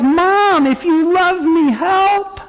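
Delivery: put-on high, wailing child's cry; mock child wailing to mom; hurting child. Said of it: "I can verbalize".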